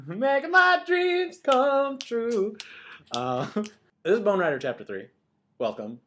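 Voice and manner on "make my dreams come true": high, slow, drawn-out crooning; dreamy sing-song. Pretend. Cohost crooning the publisher's slogan, high and slow. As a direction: croon it like a fairy-tale promise.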